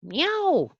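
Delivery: very high cat yowl; yowling meow. A narrator's reading. Cora the cat alarmed by the baby, high yowling meow. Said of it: "yowled Cora".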